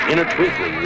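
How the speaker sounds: ordinary delivery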